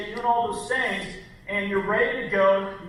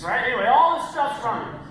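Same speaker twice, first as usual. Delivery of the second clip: ordinary talk, nothing marked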